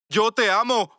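high, loud declaration; shouted love. Acted. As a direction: yell it for everyone to hear. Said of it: "Quiero gritar y decir "te amo".

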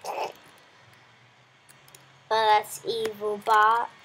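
ordinary talk, nothing marked

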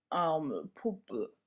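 a hint of a sobbing teddy bear; lightly voiced bear, mildly tearful; understated teddy sob. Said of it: "schluchzt der Bär".

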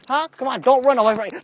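usual speaking voice

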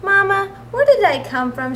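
lightly voiced curious child; little cub voice. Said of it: "she asked".

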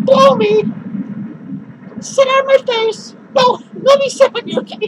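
loud, high crude taunts; taunting. Real, not acted. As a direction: provoke them, loud and cocky. Taunting his haters.

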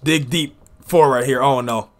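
excited live play-by-play calling; wrestling commentator. Acted. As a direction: call each move as it lands.

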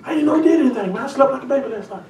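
casual street guy, high and soft, laughing; a neighbor shrugging it off, high and laughing; oblivious neighbor. Acted. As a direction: play the unbothered neighbor, high and light. Voicing a Goshen neighbor.

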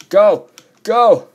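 high, very loud yells; panicked shouting. Real, not acted. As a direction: shout to get moving under attack. Fleeing an attack.

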